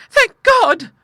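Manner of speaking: very high, loud cry of relief; fervent Clara. A narrator's reading. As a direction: exclaim with heartfelt fervour in falsetto. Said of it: "said Clara fervently".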